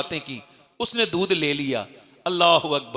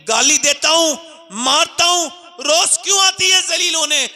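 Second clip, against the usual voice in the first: rising exasperation; 9 semitones higher than usual. Retold or imitated. Chaudhry asks why she returns.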